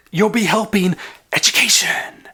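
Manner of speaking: playful slogan with a stretched ending; hammed-up announcement. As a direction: sing out the catchword theatrically. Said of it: "you gotta say it like that".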